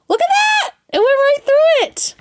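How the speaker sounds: high, loud delighted shriek; thrilled by the crisp cut, a loud high squeal; excited squeal. Real, not acted. Excited reaction to the makeup cutting through.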